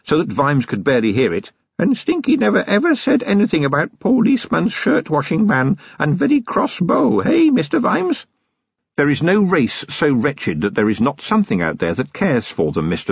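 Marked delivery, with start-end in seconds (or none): 1.8-8.3 lightly lowered goblin voice, still high; a sly goblin confiding in broken English; cracked goblin voice. Acted. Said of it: "lowered his cracked voice still further".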